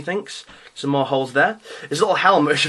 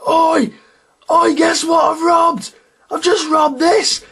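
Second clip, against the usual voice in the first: high, loud boastful shout; shouting Ogryn; 6 dB louder, slower than usual. Retold or imitated. Ogryn model bragging about his stolen cannon, loud high shout. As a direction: yell it out like a brute showing off loot. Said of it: "He's, like, shouting".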